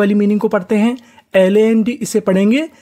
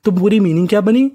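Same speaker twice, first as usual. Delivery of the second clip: ordinary talk, nothing marked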